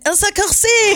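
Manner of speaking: high, playful French imitation; French accent. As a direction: echo a Frenchman announcing a name. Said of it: "the guy with the accent".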